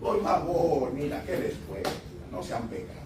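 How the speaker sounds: low, soft, sweetly begging; whisper-gentle mock plea, no force at all; pleading softly. Shown as a demonstration. Pastor showing family how soft preaching would sound, low and pleading. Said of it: "si les hablara por favorcito".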